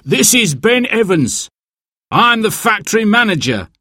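high-pitched angry phone caller; indignant manager on the phone. A narrator's reading. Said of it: "sounded angry".

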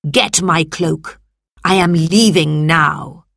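slow, imperious senator's order; angry command. A narrator's reading. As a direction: order a servant with a touch of anger, word by word. Angry senator orders his slave.

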